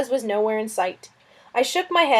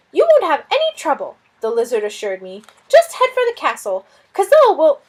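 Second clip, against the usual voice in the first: high, loud, fretful lizard voice; a flustered little lizard fretting over a guest; fussy lizard; 5 semitones higher, 5 dB louder than usual. Acted. Lizard Suz fussing about the stranger, high and loud.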